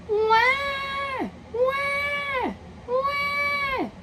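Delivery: put-on high wailing baby cry. A narrator's reading. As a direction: wail like a crying infant. Baby crying far away.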